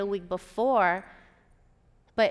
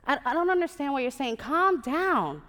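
louder, higher, mildly exasperated; exasperated calming; 6 semitones higher, 6 dB louder than usual. Acted. Station manager to upset program director on the phone, exasperated. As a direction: tell an agitated caller to calm down.

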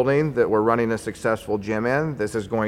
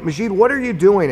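retold exasperated challenge; 9 semitones higher, 4 dB louder than usual.

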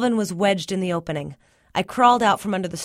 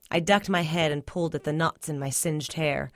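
ordinary delivery, nothing marked

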